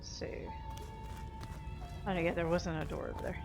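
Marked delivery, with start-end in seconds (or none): none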